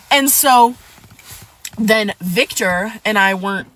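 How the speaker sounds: ordinary talk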